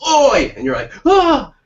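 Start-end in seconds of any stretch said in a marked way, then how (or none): 0.1-0.5 high, loud call-out; hammed-up street holler; old man in alley. Old man popping out round the corner, mock holler. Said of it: "and he goes". 1.1-1.5 high, loud mock gasp of fright; hammed-up startled cry; startled yelp. Walker surprised in a dark alley, mock yelp. Acted fright at old man.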